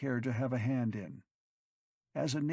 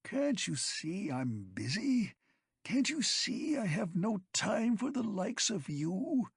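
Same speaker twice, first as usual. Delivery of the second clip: whiny self-pity; 10 semitones higher than usual. A narrator's reading. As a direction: whine it out full of self-pity. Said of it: "whined in self-pity".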